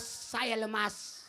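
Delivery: slow, soft worn-out sigh; exhausted. Acted. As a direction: drag it out like you're spent. Comic tired punchline.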